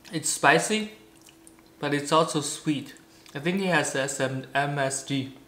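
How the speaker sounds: small bites between words; snack at lips; talking while chewing. Real, not acted. Seen 2: From 2.6s he brings the snack to his mouth and nibbles while talking.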